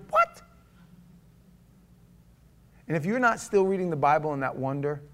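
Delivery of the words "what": high squeaked, mock-baffled question; baffled disbelief. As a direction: let it leap up in mock confusion. Baffled reaction to the verse.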